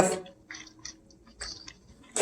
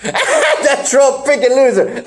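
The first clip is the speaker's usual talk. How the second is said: laughing through a repeated insult; 7 semitones higher, slower than usual. Real, not acted. Laughing as he repeats the friend's insult about Trump. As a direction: repeat the jab while cracking up.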